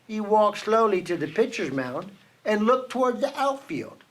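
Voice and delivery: ordinary delivery, nothing marked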